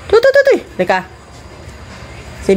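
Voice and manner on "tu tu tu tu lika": high-pitched coaxing dog call; a sing-song high call to bring the dog in. Real, not acted. Owner calling her dog Biwan in a high coaxing call.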